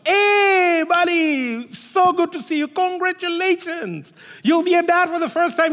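beam at him, overjoyed; excited greeting. Retold or imitated. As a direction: friend bumping into the dad-to-be, thrilled.

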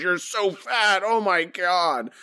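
high-pitched mock shriek, slowing at the end; mock screaming. Another woman screaming at Marge in a pitched scene, mock scream. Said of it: "another woman just screaming at Marge".

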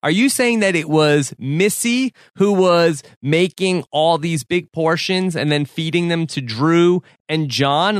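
normal talking voice

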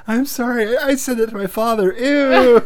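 high, loud imitation of a grossed-out kid; mock mortified daughter. Host as daughter realizing what she told her father, mock mortified.

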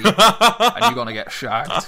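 mock rowdy uncle. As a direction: drag it out like a cheeky uncle cracking up. Mock uncle pushing youth.

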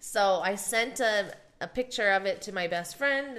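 usual speaking voice

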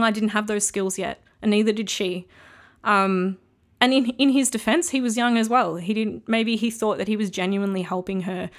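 usual speaking voice

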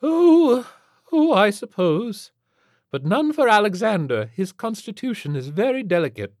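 high, loud, fluttery lady's voice; fussy reluctant lady. Acted. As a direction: give in reluctantly, then fuss protectively.

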